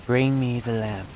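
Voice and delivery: slow mock villain voice; slow, drawn-out mock Jafar line; imitating Jafar. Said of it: "The sound of Jafar's voice".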